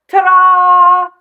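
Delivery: very high, loud showman's ta-da; a boy flinging the barn door open with a grand ta-da; ta-da fanfare. Acted. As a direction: announce it like a magic trick. Grand entrance with platter.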